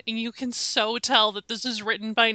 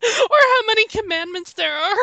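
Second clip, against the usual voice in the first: laughing; 7 semitones higher, 3 dB louder than usual. Real, not acted. Laughing at a character.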